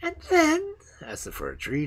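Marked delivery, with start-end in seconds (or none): 0.0-0.7 much higher, louder eager question; trembling eagerness. Acted. The fir tree begs the sparrows for more, high and eager. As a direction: burst out eager to know. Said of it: "trembling in every limb with eagerness".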